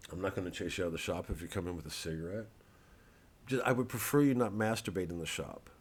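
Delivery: usual speaking voice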